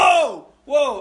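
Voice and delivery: loud, high "whoa" of alarm; retold alarmed shout. Friends seeing Squirrel pull a knife, alarmed shout. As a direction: yell it like the knife just came out.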